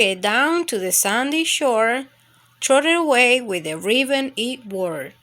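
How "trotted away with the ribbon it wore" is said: ordinary delivery, nothing marked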